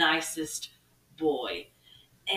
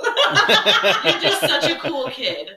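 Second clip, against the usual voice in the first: higher, laughing, slowing down; laughing backpedal; 7 semitones higher, 12 dB louder than usual. Retold or imitated. Teacher backpedalling to the girl, laughing. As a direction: cover the mistake with forced praise. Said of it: "I was like".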